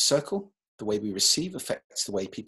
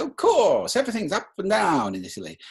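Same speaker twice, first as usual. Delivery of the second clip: big up-and-down swoops on "Of course"; loud, high, swinging "up and down" melody; Italian-style sing-song; 10 semitones higher, 7 dB louder than usual. Shown as a demonstration. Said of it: "The Italians are masters of prosody".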